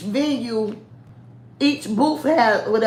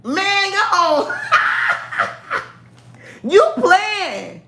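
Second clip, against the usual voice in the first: laughing protest; 10 semitones higher, much slower than usual. Real, not acted. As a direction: laugh and wave them off, pitched way up. Teasing back when asked for the accent.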